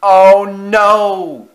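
high, very loud, drawn-out cry; terrified cry. A narrator's reading. Engine sees trucks heading right at him, terrified cry. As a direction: brace for the crash and cry out. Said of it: "he cried as he shut his eyes".

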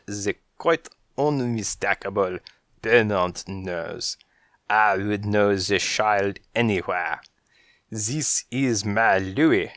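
put-on slow, lightly French-accented gentleman; measured slow French accent, a bit grand. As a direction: savour each word like a suave French cat. Jean-Claude speaks, called Frenchie.

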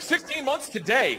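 lightly mocking a precise parent, drawn out; mock proud dad.

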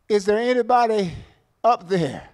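a desperate call upward. Retold or imitated. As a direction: call up to the sky for help. Man hanging from branch calls out.

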